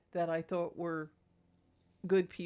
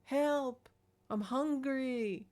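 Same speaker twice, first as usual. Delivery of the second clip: higher, slow whiny cat voice; 6 semitones higher, much slower than usual. Retold or imitated. Old cat Salem telling Mama he's nauseous, in a slow high whine. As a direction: plead slowly like a sick cat. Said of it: "cat Salem said".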